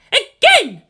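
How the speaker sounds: loud, high shout. A narrator's reading. Misato shouting at the screen, loud and high. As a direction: blast the last word. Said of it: "she shouted up at the main screen".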